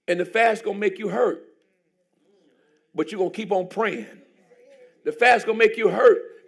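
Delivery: loud, driving sermon; full-force preaching. Acted. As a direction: preach it to the back row.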